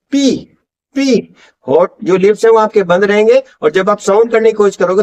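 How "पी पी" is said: "पी" very slowly, lips shut and then popped open; teacher pops "पी, पी" slowly; slow P demo. Shown as a demonstration. Teacher slowly showing students how lips open for "पी". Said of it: "चाहे तो बोल के देख लीजिए".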